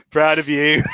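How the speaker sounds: laughing through it, high. Pretend. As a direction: giggle the words out. Mock praise while laughing.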